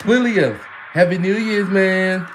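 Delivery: high, loud shout; loud greeting. Real, not acted. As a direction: call out to a friend. Greeting a friend loudly.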